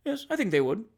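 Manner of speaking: indifferent. A narrator's reading. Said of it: "with an air of indifference".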